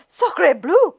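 high French-exclaiming duck; excited cry. A narrator's reading. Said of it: "cried the duck".

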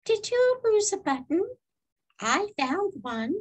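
high, piping little bird; high-pitched sparrow character. A narrator's reading. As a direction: ask like a helpful sparrow. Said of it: "said the sparrow".

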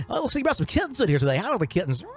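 high, fast gushing DJ patter; cheesy radio DJ. Retold or imitated. DJ fawning over the shelter kittens, hammed-up high and fast. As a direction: gush over the kittens like a small-town DJ. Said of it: "people that gotta talk like this".

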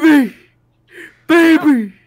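high, loud wail of mock heartbreak; hammed-up pleading. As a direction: wail after a partner who is leaving. Fed lines begging not to be dumped.

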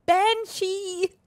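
loud high shout of the name; shouting a voice command at the game. Shown as a demonstration. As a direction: yell Banshee at the game. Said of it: "Banshee sagen und mit der Voice".

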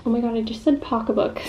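muffled behind fingers; speaking through cupped hand; hand over mouth. Real, not acted. Seen 0: Hand covers her mouth from 0.1 to 1.0s, lowered by 1.2.

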